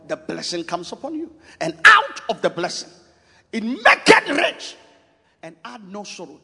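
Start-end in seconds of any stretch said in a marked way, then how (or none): 1.6-4.8 preaching climax. Real, not acted. Preacher crying out the riches of the blessing. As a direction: stretch out maketh rich, ringing.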